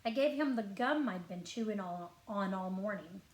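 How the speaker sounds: ordinary delivery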